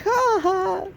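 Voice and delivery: high mocking ha ha, imitating the women; a mock high cackle of scorn; mocking laugh. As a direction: laugh at him in a put-on high voice. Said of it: "all the women were all, like, laughing".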